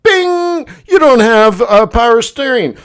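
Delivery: high bing chimes, then a slow robotic readout; car warning voice. Retold or imitated. The car's computer listing lost systems, chiming high. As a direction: play the dashboard announcing failures. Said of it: "it's like".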